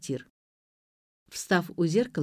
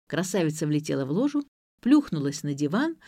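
usual speaking voice

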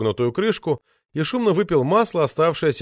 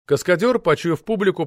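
ordinary delivery

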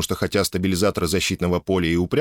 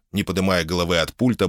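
usual speaking voice

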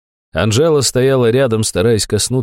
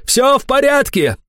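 very high, strained call; yelling. A narrator's reading. Boss calls off his armed men, yelling. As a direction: holler that all is well. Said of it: "заорал тигрёнок".

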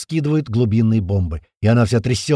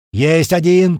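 loud, raised triumphant call; shouted report; 4 semitones higher, 5 dB louder, much slower than usual. A narrator's reading. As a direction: shout the kill up to the crew. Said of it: "кричит снизу". (громкий победный возглас)

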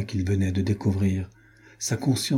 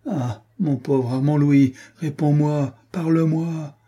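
mildly tearful, higher-pitched pleading; tearful plea; 6 semitones higher, slower than usual. A narrator's reading. Renaud begs his wounded friend to answer, tearful plea. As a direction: plead with a touch of grief, drawn out at first. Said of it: "il pleurait".

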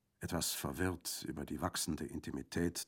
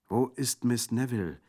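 anxious question; 6 semitones higher, 7 dB louder than usual. Acted. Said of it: "fragte Orso".

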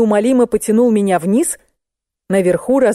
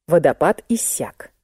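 ordinary delivery, nothing marked

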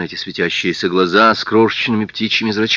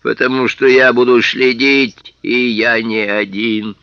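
slow, loud, menacing; slow menacing threat; 3 semitones higher, 6 dB louder, slower than usual. Acted. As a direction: warn him slowly. Policeman threatening.

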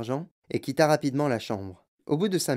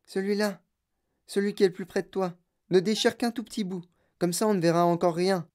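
put-on a boy's higher, quick voice; young Charlie, higher and eager; 6 semitones higher than usual. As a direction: Charlie guiding grandpa, eager. Charlie character voice. (voix de petit garçon impatient)

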